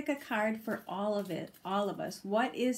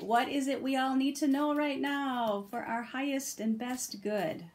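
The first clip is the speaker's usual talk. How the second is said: ordinary delivery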